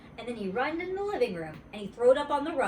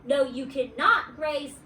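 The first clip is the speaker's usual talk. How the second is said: angry retort; 6 semitones higher, 6 dB louder than usual. Acted. Playground quarrel.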